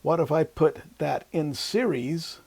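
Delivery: ordinary delivery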